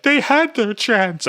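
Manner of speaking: high, slow, loud cheesy line; mock movie extra. Extra gets a reaction shot, said in high slow mock drama. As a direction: drag out a corny action-movie line. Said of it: "it'll cut to one, and they're like".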